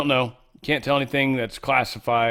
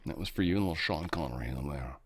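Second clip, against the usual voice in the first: lower, softer Sean Connery Scottish voice; Sean Connery impression; 4 semitones lower, 8 dB quieter than usual. Nodding to Highlander fans in a Sean Connery voice. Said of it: "with his Sean Connery accent".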